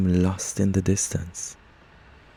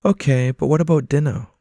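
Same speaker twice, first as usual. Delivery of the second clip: her voice, higher; 8 semitones higher, 6 dB louder, faster than usual. Acted. She answers, higher voice.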